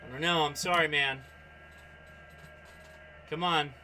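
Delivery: ordinary delivery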